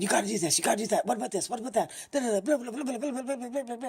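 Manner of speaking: mock babble of voices crowding your head; nagging inner voices. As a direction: rattle off the demands of racing thoughts. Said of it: "all these voices in your head saying".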